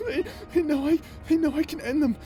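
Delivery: stammering through tears; desperate crying plea. Acted. Tormented soul urging the hero to strike, sobbing. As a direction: beg them to do it, breaking down.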